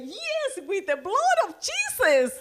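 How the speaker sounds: high, loud, exultant outburst; re-enacting a fired-up new believer; excited in the spirit. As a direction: cry it out with over-the-top spiritual excitement. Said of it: "I was so excited in the spirit".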